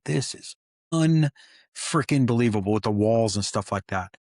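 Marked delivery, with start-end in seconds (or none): 0.0-2.7 awed disbelief. Retold or imitated. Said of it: "I'm like".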